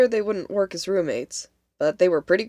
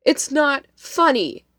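louder, higher, drawn-out annoyed snap; snapping; 8 semitones higher, 6 dB louder, slower than usual. A narrator's reading. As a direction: snap back, stretching out the words in irritation. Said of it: "Devon snapped".

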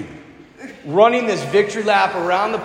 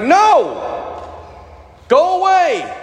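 high, louder shooing yell; irritated shout; 11 semitones higher, 4 dB louder, much slower than usual. Retold or imitated. Tourist shooing the begging girl, loud annoyed yell. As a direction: brush her off sharply.

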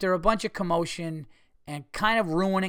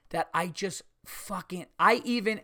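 ordinary talk, nothing marked

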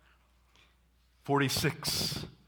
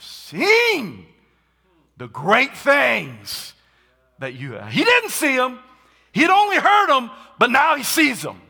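preaching climax; 13 semitones higher, 14 dB louder than usual. Acted.

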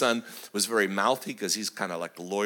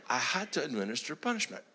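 usual speaking voice

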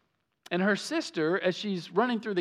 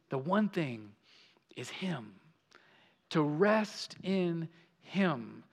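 hushed emphasis; 4 semitones lower, 6 dB quieter, slower than usual. Real, not acted. Sermon quiet climax.

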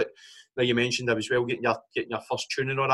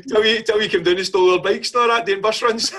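laughing; 8 semitones higher, 3 dB louder than usual. Real, not acted. Teasing back while laughing.

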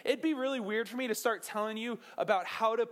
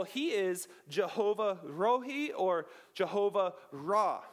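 ordinary talk

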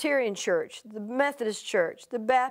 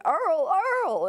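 mock screaming of a name, pitched higher; a hint of a wife's panicked scream; retold screaming; 7 semitones higher, 4 dB louder than usual. Wife screaming over her fallen husband, mild mock scream. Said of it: "is screaming".

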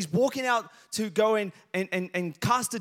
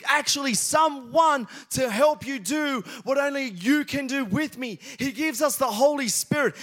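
raised, high, emphatic sermon voice; full-force preaching; 6 semitones higher, 4 dB louder than usual. Real, not acted. Preacher assuring church God gives the ability, loud and fervent. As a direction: build fervour and press every phrase.

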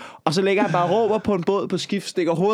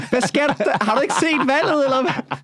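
higher-pitched mock telling-off; mock scolding a shark; 9 semitones higher than usual. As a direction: tell off the stupid shark, pitched up. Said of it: "du talte til hajerne". (spottende skældud til den dumme haj, højt)

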